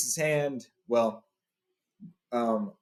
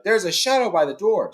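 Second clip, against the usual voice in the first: higher, louder alarm shout; goblin shouting; 8 semitones higher, 5 dB louder than usual. A narrator's reading. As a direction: raise the alarm. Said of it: "one of the goblins inside shouted".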